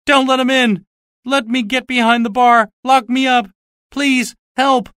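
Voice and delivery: high, loud, screaming plea; terrified scream. Acted. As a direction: scream in panic. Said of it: "Marvel screamed".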